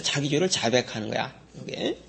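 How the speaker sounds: ordinary delivery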